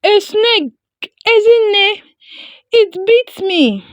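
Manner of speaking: high, loud, panicked cry; gasping in pain. A narrator's reading. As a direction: call out to his sister after the snakebite. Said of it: "Obi gasped".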